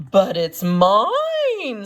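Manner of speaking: slow, loud indignant cry; a mild mock scream. A narrator's reading. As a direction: drag out the claim angrily. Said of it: "screamed the bird".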